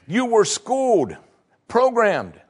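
high, ringing sermon voice; preaching at full force. Acted. Preacher telling good people they struggle with grace, high and ringing. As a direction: drive the point home to the pews.

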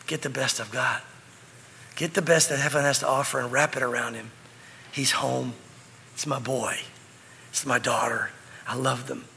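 low, soft, heartfelt welcome; tender father. Acted. A father welcoming his child home, tender and low.

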